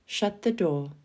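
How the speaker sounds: ordinary talk, nothing marked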